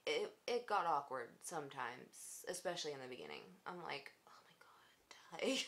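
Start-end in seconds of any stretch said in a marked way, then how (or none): 4.3-5.6 slow, breathless whisper; drawn-out voiceless whisper of embarrassment; whispered dread. Retold or imitated. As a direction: hush it like a dying groan. Mock-horrified inner reaction quote.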